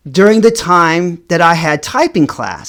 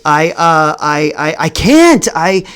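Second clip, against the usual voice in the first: flustered stammer with jumps in pitch; acted-out nervous stutter; fumbling words. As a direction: stumble over every start, protesting nervously. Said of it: "I'm, like, fumbling my words".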